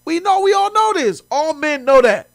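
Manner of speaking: high and loud, fired up; hyped opener; emphatic declaration. Real, not acted. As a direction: announce it like everyone agrees. Opening rant to chat.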